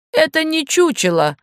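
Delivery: high-pitched indignant protest. A narrator's reading. Kolya defends Grishka the bird, indignant and high. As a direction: object hotly, pitch jumping well up. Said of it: "возмутился Коля".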